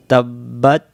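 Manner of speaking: drawn-out melodic recitation; chanted Quranic recitation; slow Quran recitation. Shown as a demonstration. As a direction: recite it slowly and devoutly. Recites verse slowly.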